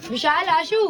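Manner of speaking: high-pitched call across the house. Real, not acted. Vlogger calling the kids by name inside the old house, high call. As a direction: call the names out high so they carry.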